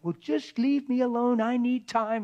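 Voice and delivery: high, loud mock snap; snappy brush-off. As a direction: bark it like a defensive spouse. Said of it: "don't be like".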